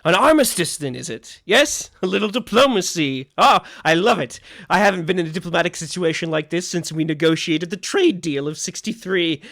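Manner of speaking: gushing hostess. Acted. Franny welcoming the guests inside. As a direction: play her as a delighted tipsy hostess.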